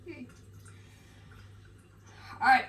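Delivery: ordinary delivery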